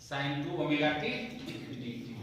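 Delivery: "sin टू omega T DT" drawn out word by word; teacher slowly dictating "sin टू omega T DT" to the class; slow dictation. Shown as a demonstration. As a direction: repeat it slowly so they can write it. Teacher repeats term for dictation.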